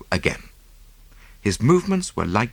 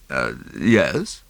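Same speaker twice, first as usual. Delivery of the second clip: a hesitant, lower yes; 5 semitones lower than usual. A narrator's reading. Husband answers Poirot hesitantly, mildly unsure. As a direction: answer with a slight hitch. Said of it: "he said hesitatingly".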